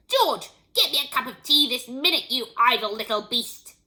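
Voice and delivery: loud, scolding demand; hollered order turning to insult; bad-tempered yell. A narrator's reading. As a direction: yell it as a cross, bossy grandma. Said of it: "she yelled".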